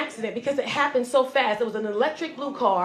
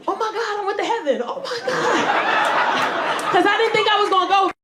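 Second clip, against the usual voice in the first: high, loud cries of joy; ecstatic praise; 9 semitones higher, 5 dB louder than usual. Acted. Woman waking after crash thinks she's in heaven, ecstatic praise. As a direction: shout it like a church praise break. Acts out believing she reached heaven.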